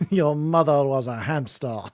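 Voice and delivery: put-on French taunter's accent from a comedy film; mock French accent. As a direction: hurl it as a hammed-up French insult. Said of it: "Monty Python with the French guy".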